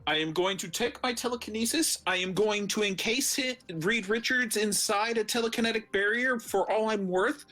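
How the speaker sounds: normal talking voice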